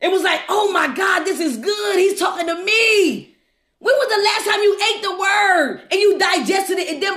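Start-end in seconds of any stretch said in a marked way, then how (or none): none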